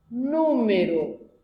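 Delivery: Número said slowly with NÚ stressed; slow Número, first syllable NÚ strongest; teacher showing a proparoxítona; slow stress demo. Shown as a demonstration. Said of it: "última".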